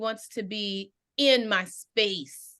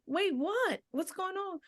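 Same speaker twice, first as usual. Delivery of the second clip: imitating alarmed surprise; 10 semitones higher, 5 dB quieter than usual. Alarmed reaction to crying coworker.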